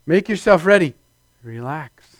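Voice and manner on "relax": soft calm. Real, not acted. Preacher calming congregation.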